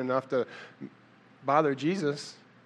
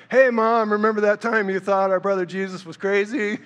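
higher, louder mock ribbing of Mom; teasing sibling; 4 semitones higher, 5 dB louder than usual. Brother teasing Mom at Thanksgiving dinner, playful jab.

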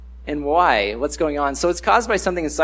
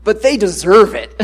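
mock defiant; 3 semitones higher, 6 dB louder than usual. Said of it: "even if we own it".

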